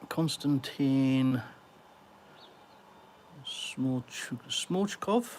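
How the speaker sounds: Konstantin drawn out slowly, Smorchkov broken up and lifted into a question; tentative name attempt. Real, not acted. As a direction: sound out Konstantin Smorchkov haltingly, unsure of Smorchkov. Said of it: "Let me have a go at that".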